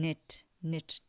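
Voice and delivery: very slow, drawn out knit; teacher modelling a sound slowly; slow sound demo. Shown as a demonstration. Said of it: "like this".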